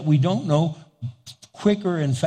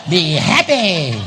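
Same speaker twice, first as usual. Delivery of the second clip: slow, louder, laughing be happy; louder laughing repeat; 6 dB louder, slower than usual. Shown as a demonstration.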